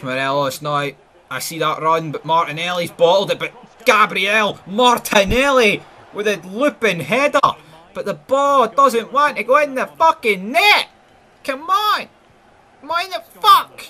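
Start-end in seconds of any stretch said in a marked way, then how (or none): 3.4-13.8 climbs much higher and louder to an anguished cry; rising frustrated commentary. Real, not acted. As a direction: build from hype to disbelief. Near-miss header, building frustration.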